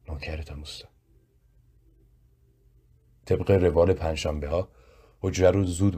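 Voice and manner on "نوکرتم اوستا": choked-up voice. A narrator's reading. Worker thanking his boss for money pressed into his hand, choked up. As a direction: say it softly, with a catch in the voice.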